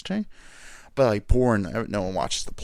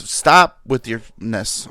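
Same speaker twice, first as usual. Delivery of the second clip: louder, slow firm scolding; scolding dogs; 9 dB louder, slower than usual. Real, not acted. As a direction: tell the dogs off slowly.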